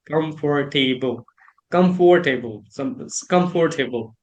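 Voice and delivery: "Comfortable" as com-for-TAY-bul; full "for" and "table" inside Comfortable; old four-syllable COMFORTABLE. Shown as a demonstration. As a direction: pronounce every written syllable of "comfortable". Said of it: "I used to say like it".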